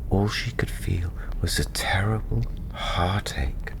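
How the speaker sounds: ordinary delivery, nothing marked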